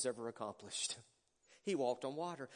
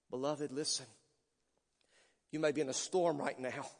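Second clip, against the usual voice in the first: ordinary talk, nothing marked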